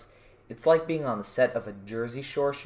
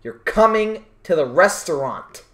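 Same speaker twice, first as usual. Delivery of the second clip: insistent staff; 6 semitones higher, 13 dB louder, slower than usual. Retold or imitated. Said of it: "they're like".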